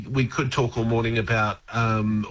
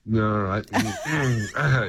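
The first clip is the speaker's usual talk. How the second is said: ordinary talk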